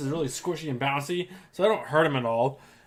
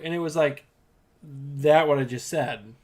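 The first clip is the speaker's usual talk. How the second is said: ordinary delivery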